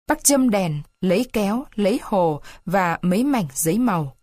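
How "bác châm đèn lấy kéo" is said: ordinary delivery, nothing marked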